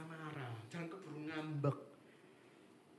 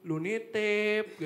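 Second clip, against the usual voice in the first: louder, slow drawn-out reproach; mock complaint; 7 dB louder, much slower than usual. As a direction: stretch out "Lu nitip" like a nagging friend. Friend complaining about the errand.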